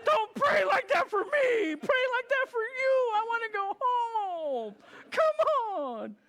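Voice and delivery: very high, loud, crying-shouted pleading; half-laughing howl, slowing on I wanna go home; mock anguished wail. To Ben about his prayer, a high comic crying shout. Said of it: "I'm like".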